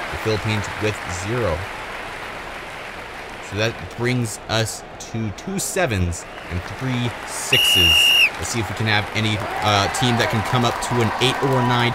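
usual speaking voice

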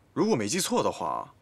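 ordinary talk, nothing marked

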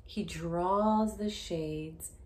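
loud, slow, dramatic read-aloud; storybook reading. Acted. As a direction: lean into each word for suspense. Story narration, louder slower.

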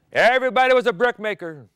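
loud high declaration; proclaiming. Acted. Preacher booming to the congregation about slavery. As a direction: ring it out to the congregation.

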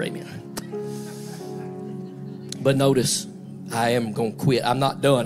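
ordinary talk, nothing marked

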